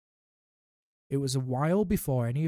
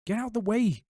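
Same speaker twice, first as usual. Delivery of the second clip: snapping; 9 semitones higher, 3 dB louder than usual. A narrator's reading. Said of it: "snapped Ron".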